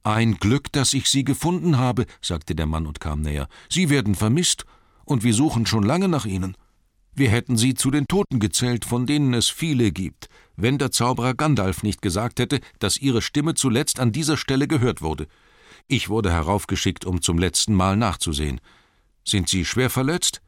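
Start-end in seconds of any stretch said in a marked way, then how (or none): 0.0-2.1 raised, louder voice, glad of the find; relieved messenger. Acted. Man finding missing Bilbo on the hill, relieved and loud. 3.7-20.4 raised, louder voice, glad of the find; relieved messenger. Acted. Man finding missing Bilbo on the hill, relieved and loud.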